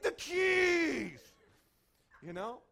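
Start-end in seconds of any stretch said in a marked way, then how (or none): 0.0-1.2 high, loud, drawn-out yell; exasperated high-pitched holler; angry shout. Retold or imitated. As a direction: bellow it, stretching the last word. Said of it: "I was angry".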